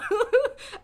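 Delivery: loud high giggle; a quick loud laugh. Real, not acted. Laughing about murderous hero.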